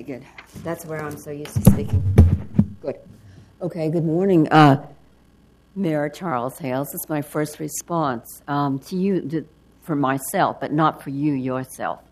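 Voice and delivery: ordinary talk, nothing marked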